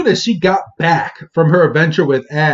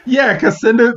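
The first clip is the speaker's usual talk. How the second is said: normal talking voice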